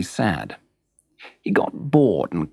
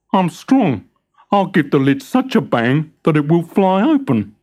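higher, louder boastful hammer voice; proud hammer; 6 semitones higher, 4 dB louder than usual. Acted. Hammer bragging he will bang the lid open, higher and louder. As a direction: puff up like a proud strong hammer. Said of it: "said the hammer".

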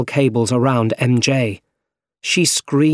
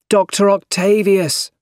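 high call across the room; 8 semitones higher than usual. A narrator's reading. Spider-Man calls out to the villain. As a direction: call his name out high. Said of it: "called Spider-Man".

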